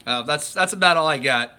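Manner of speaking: ordinary talk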